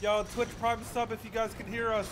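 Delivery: calling out. Real, not acted. Calling out to chat.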